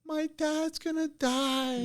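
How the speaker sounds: very high, slow, long-held mock wail; imitated panicked wail. Kid on the boat cries out for drowning dad, high imitated wail. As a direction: imitate a distant scream, stretched thin and high. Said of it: "I'm hearing this and panic and scream".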